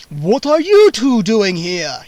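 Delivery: put-on slow, loud, commanding old vampire; a booming count who wants answers; vampire demanding. A narrator's reading. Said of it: "he demanded".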